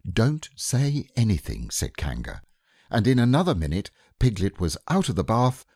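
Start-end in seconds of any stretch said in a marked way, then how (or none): 0.1-1.7 slow, higher gentle hush; soothing mother. Acted. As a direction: hush the child. Kanga voiced in story.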